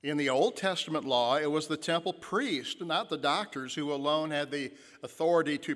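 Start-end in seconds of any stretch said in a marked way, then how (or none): none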